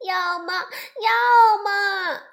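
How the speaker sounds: high, loud wailing cub; crying tantrum. Acted. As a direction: howl it through tears. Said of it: "孩子们大声喊着". (小熊妹妹摔在地上，哭闹着大喊要嘛)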